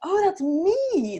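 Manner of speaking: high, drawn-out retold realization; dawning realization. Woman recounts recognizing herself in the child, astonished realization. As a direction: let it land slowly, pitched well up.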